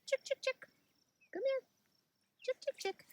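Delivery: high sing-song chick call; calling chickens. Real, not acted.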